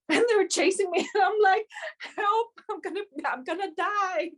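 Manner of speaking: high, loud laughing mock panic. Retelling being chased by dogs, laughing mock panic. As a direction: squeal through laughter as if fleeing dogs.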